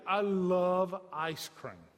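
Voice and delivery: "love" drawn out slowly; emphatic love. Real, not acted. Preacher confessing a weakness for ice cream, emphatic. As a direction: lean long and hard on "love".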